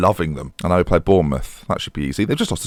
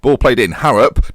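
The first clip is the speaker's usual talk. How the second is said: normal talking voice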